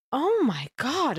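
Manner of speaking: mock horror, stretched out slowly; retold shock. Telling friend of opening the bill, retold shock. Retelling reaction to getting the bill.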